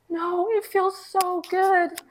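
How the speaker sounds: high, drawn-out tearful boy imitation; slow, high retold sobbing-child voice; crying child. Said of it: "he's crying".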